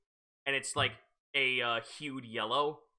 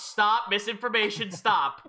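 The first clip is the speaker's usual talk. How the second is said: loud laughing stop; laughing shout; 8 semitones higher, 7 dB louder than usual. Real, not acted. GM stopping wrong map claim.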